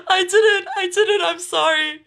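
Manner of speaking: high, loud denial through laughter; laughing apology. Real, not acted. Streamer apologizing to Sal for a mistake, laughing helplessly. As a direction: protest helplessly while laughing.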